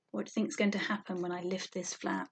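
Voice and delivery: plain reading voice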